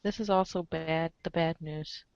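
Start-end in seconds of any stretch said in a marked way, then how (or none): none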